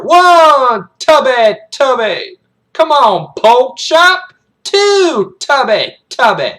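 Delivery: loud drill-sergeant cadence; high shouted numbers, falling on each nickname; taunting count. Acted. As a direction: goad the cadet through each rep. Sergeant taunts cadet during sit-ups.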